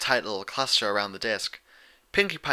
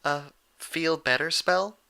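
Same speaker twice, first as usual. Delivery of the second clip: Fluttershy; 6 semitones higher, slower than usual. Acted. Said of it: "Fluttershy asked".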